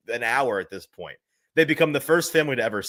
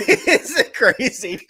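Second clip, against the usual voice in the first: laughing; 6 dB louder, much slower than usual. Real, not acted. Laughing after the quote.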